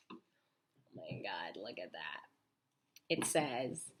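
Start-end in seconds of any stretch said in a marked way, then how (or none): 0.1-2.2 hushed whisper; whispered awe. Real, not acted. Showing the kids the picture: awed whisper.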